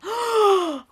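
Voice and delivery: high sharp gasp of realization. Retold or imitated. Waking up with a sudden epiphany, sharp gasp. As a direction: gasp as it suddenly hits you.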